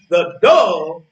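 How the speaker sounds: usual speaking voice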